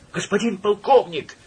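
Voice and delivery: high, confused and relieved cry; flustered glad shout. A narrator's reading. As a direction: call out, flustered yet glad. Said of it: "сметенно и в то же время обрадованно закричал".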